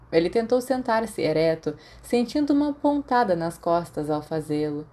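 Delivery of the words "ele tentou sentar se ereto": usual speaking voice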